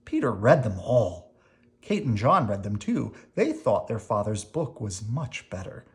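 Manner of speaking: usual speaking voice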